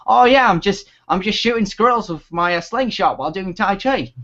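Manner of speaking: cheery mock-Australian phone voice; bad Australian accent. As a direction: imitate granddad in a rough Australian accent. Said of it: "Excuse my bad Australian accent".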